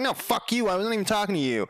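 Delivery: high-pitched retold comeback; mock-indignant protest; indignant retort. Acting out his argument back.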